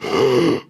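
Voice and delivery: acted winded gasp; out-of-breath gasp. Comic acting out arriving after a run, winded gasp. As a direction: gasp for air as if just done sprinting. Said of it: "I'm out of breath".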